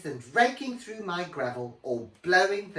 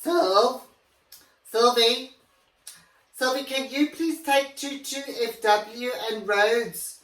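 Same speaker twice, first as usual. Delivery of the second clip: calling out; 7 semitones higher, 4 dB louder than usual. Acted. Calling the assistant across room.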